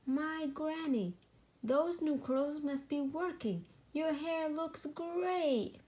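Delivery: put-on high, slow sweet old granny. Sheep in curlers fooling the farmer as Granny.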